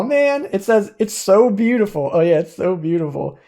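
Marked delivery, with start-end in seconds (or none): 2.1-3.4 dry mock praise; sarcastic echo. Real, not acted. Gamer mocking the useless cursed horn's card text, sarcastic. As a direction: say it like you don't mean it.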